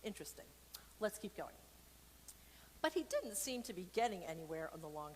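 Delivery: ordinary delivery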